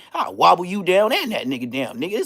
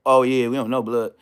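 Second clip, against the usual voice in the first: normal talking voice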